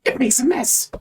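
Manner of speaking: high-pitched complaint; acted high, put-upon voice; fussy library dweller. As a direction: object fretfully to the mess. Library dweller objects to sword test.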